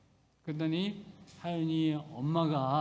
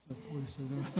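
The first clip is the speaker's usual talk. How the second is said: quiet aside; 3 semitones lower, 9 dB quieter, faster than usual. Real, not acted. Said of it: "quiet aside repeat".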